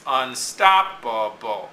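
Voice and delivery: unstoppable slowly, STOP stressed; slow unstoppable, punching stop. Shown as a demonstration. Teacher marking the meter, slow unstoppable with STOP stressed. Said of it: "marking the meter of it".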